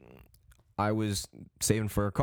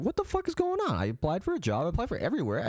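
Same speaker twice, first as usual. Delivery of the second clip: high-pitched indignant protest; squeaky outrage without shouting; frustrated; 11 semitones higher than usual. Retold or imitated. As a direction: pitch it way up in exasperation. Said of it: "starting to get frustrated".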